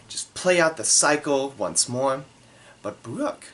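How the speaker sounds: normal talking voice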